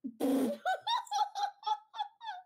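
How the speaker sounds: long, high burst of laughter. Real, not acted. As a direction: crack up loudly. Big laugh.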